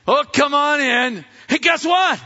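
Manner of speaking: high, loud, bursting exchange; excited greeting. Acted. Women arrive at the disciples' with news, excited. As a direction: call it out, acting eager.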